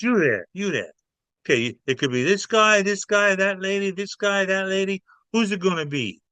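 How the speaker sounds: pointing at suspects. Retold or imitated. Mafia guy picking audience suspects.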